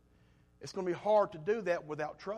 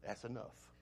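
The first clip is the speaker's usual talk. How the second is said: quiet firm stop; 3 semitones lower, 12 dB quieter, slower than usual. Retold or imitated. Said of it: "So I said".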